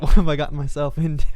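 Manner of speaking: quick words broken by laughter; laughing through it. Retold or imitated. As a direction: laugh while quoting the panic. Retold panic laughing through quote.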